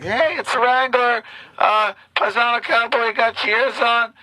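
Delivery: put-on high, hopeful kid radio call; imitated child radio voice; young self on CB. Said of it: "I would try to be like".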